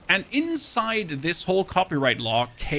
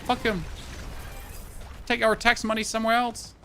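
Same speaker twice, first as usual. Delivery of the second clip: defiant shrug; 6 semitones higher, 3 dB quieter than usual. Retold or imitated.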